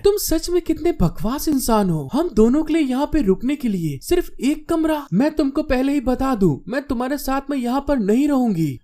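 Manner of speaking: high-pitched indignant complaint; angry girl. A narrator's reading. Girl refusing to share one room with him, angry. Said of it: "गुस्से में बाहर निकलते हुए कहती है".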